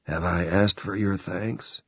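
dry, unused, dusty voice. A narrator's reading. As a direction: rasp like an old book. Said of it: "a voice that sounded as dusty and little used".

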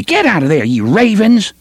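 high, sharp scolding call; sharp woman scolding. Acted. Woman chasing the boys off, sharp scolding call. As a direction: shoo them off like birds. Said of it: "a woman's voice called sharply".

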